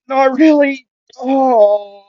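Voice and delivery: high, loud, hurt wail; pained cry. Acted. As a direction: answer with an acted groan of pain. Injured character answering.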